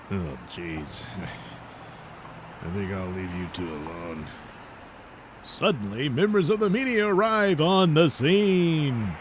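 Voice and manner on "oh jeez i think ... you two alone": low, soft embarrassed mumble; awkward exit. Acted. Bystander leaving the two heroes to bicker, low embarrassed murmur. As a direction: back away quietly from the quarrel.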